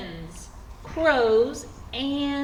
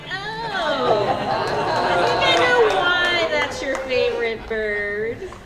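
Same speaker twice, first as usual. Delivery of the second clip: loud, high, drawn-out "aha" delivery; mock realisation; 4 semitones higher, 9 dB louder, slower than usual. Host teasing guest about his duck outfit, loud mock realisation. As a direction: play up the sudden penny-drop, slowly.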